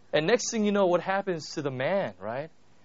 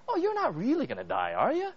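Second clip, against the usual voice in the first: sly, teasing serpent voice, higher; tempting serpent; 7 semitones higher than usual. Retold or imitated. Serpent coaxing Eve with a sly, high lilt. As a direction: coax like the snake in the garden.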